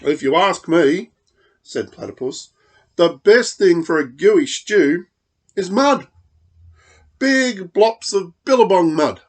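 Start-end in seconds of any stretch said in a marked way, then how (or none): 0.0-1.1 higher and louder know-it-all; bossy platypus. Acted. Platypus advises Dingo on the stew, loud and high. 3.0-9.2 higher and louder know-it-all; bossy platypus. Acted. Platypus advises Dingo on the stew, loud and high.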